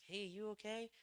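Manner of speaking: high, loud call across to a stranger. Retold or imitated. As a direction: call out to check on him. Partner calling to sleeping man.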